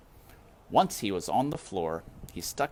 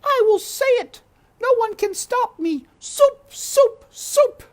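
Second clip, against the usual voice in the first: very high, loud, slow wail of a distraught cook; high loud cook's lament; shouting cook; 12 dB louder than usual. A narrator's reading. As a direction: cry it out like a frantic old cook. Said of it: "shouted Cook".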